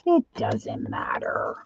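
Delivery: slow, low, mostly unvoiced; slow croaky aside to yourself; drawn-out repeat. Real, not acted. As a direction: stretch it out under your voice.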